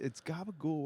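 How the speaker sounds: Italian-American gabagool, dropped ending; Italian-American accent. Retold or imitated. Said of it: "What was the other one".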